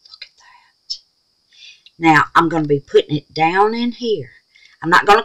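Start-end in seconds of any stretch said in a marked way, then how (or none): 0.1-1.0 hushed, unvoiced admiration; awed whisper. Real, not acted. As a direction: whisper it like a secret delight. Admiring the glitter.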